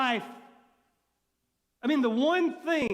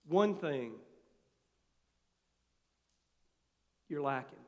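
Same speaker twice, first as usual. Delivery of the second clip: tender; 8 semitones lower, 6 dB quieter than usual. Acted. Jesus speaks with love.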